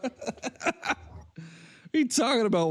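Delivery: laughing through the question; real laugh, amused disbelief at the caller. Real, not acted. Host laughing at a troll caller's accent, amused disbelief.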